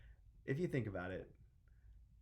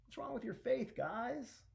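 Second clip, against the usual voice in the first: exasperated chiding; 12 semitones higher than usual. Acted. Jesus chides panicked disciples.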